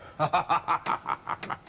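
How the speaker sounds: a breathy, unvoiced chuckle; amused laugh. Real, not acted. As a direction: laugh it off lightly. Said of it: "What was all the laughing for?".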